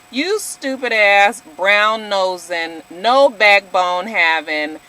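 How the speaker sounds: slow, deliberate name-calling; contemptuous, measured put-down; drawn-out insults. Real, not acted. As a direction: lay out each insult slowly, one by one. Insulting Susie directly in a rant.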